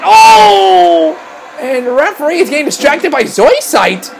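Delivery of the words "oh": drawn-out cry of impact; shocked reaction. Real, not acted. Commentator watching Jax get hit, shocked cry. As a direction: react as the blow lands.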